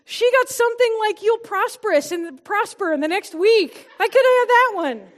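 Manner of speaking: high, loud, exasperated complaining; whiny self-pity. Retold or imitated. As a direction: gripe like a jealous little sister. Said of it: "I'm like".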